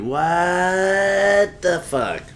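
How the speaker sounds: long drawn-out, rising wail of disbelief; real exasperation. Real, not acted. Host at a loss with his iPhone, exasperated. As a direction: stretch the first word very slowly, high. End of rant about phone.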